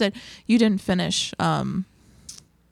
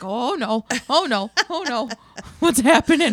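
mock alarm; 5 semitones higher, 4 dB louder than usual. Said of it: "I get scared".

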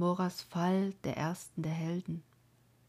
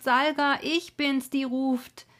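louder, higher call across the valley; 8 semitones higher, 7 dB louder than usual. Acted. Maiden calls across the valley to her lover, loud cry. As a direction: cry his name out into the wind.

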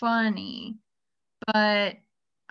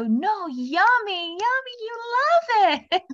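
much higher, slow, laughing "yummy"; sing-song coaxing; 11 semitones higher, 4 dB louder, slower than usual. Retold or imitated. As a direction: sweet-talk the toddler into liking it. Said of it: "And I go".

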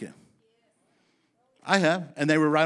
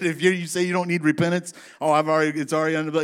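louder, higher mock voice of a blunt old preacher; 4 semitones higher, 6 dB louder, faster than usual. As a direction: imitate the brother mocking excuses, loud. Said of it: "he said it like this".

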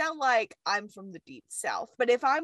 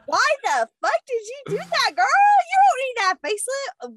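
high, loud, playful telling-off; mock outraged scolding; 12 semitones higher, 7 dB louder than usual. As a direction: scold her like a nosy friend, pitched way up. Mock scolding phone call to Queen Mary.

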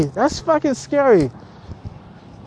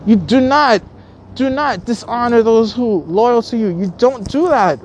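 normal talking voice

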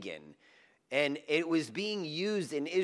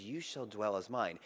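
tender; 5 semitones lower, 7 dB quieter than usual. Shown as a demonstration. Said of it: "that's not what he means".

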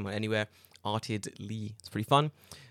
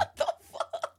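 laughing whisper. Real, not acted. Laughing at kanji.

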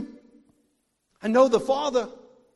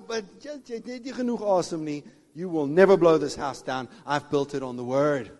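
ordinary talk, nothing marked

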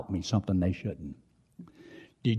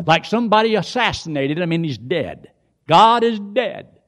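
full-force preaching; 9 semitones higher, 8 dB louder than usual. Real, not acted. Preacher challenges those living without a shepherd, preaching forcefully.

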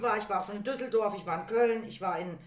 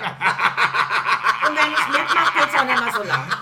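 laughing; 9 dB louder, much slower than usual. Real, not acted. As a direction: crack up while saying it. Laughing joke punchline.

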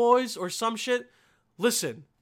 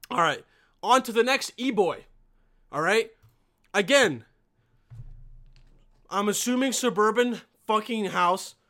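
ordinary delivery

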